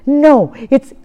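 ordinary talk, nothing marked

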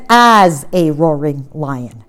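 "as" hit loud and drawn out, then falling; stress on AS. Shown as a demonstration. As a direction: lean hard on "as", slowing the phrase. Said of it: "Now, it doesn't say he's a roaring lion".

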